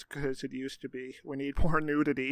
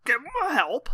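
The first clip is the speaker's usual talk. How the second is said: mock British accent; 11 semitones higher, 4 dB louder than usual.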